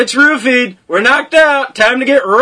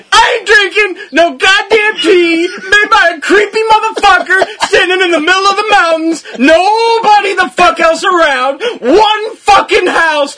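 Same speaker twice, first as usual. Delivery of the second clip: raging rant; 9 semitones higher than usual. Retold or imitated. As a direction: blow up at the creepy innkeeper, barely holding in laughter. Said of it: "I fucking rage on this. I'm like".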